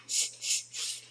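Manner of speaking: soft voiceless hisses; short, quiet snake hisses. Shown as a demonstration. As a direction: hiss like a snake for a toddler. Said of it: "what sound does a snake make".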